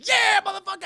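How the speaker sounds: high, loud triumphant yell; victory whoop. Retold or imitated. Busta and Sarah celebrating the kill, loud victory yell. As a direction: cheer like you just won the fight.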